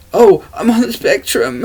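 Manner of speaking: put-on high voice, making fun; mocking imitation. Mimicking people who label themselves.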